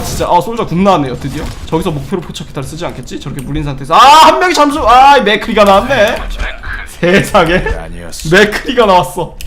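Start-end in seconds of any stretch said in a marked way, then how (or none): 4.0-9.4 slow, loud commentator call; wrestling commentator. Acted. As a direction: call it like a ringside announcer. Play-by-play commentary.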